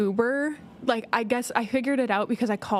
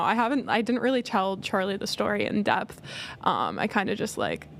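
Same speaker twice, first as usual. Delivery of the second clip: normal talking voice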